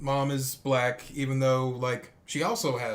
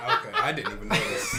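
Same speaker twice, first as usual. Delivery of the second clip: high, laughing admission; amused, voice leaping up with laughter; laughing while talking; 5 dB louder than usual. Real, not acted. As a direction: talk through real giggles. Laughing at friend's abandoned accent attempt.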